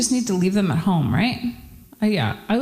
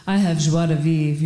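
put-on low, seductive French accent; 5 semitones lower than usual. French guy at a party charms a woman, French accent. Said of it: "a French guy says".

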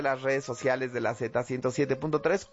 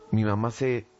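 ordinary delivery

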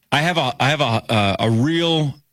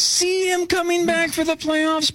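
very high mock-hopeful musing; hammed-up wishful wondering; subtle optimism. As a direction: float the question up in a singsong high pitch. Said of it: "there's little subtle optimism".

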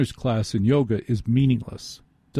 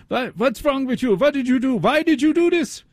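very high, hammed-up German scolding, climbing higher; yelling German accent; 13 semitones higher than usual. Surgeon berating the injured patient, shrieky mock German accent. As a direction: imitate a doctor berating someone in a silly German accent. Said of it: "yelling at me with a German accent".

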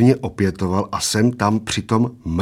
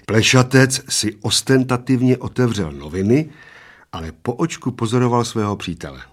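usual speaking voice

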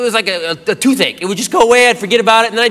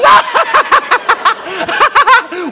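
high, hammed-up cackle; mock girlfriend's uncontrollable laughter; imitated laughing fit; 13 semitones higher, 4 dB louder than usual. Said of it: "And she goes".

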